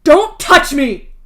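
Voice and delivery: loud, slow, desperate shout. Acted. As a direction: scream it, push him away. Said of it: "Dave shouts at him".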